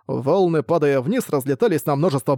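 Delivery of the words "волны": ordinary delivery, nothing marked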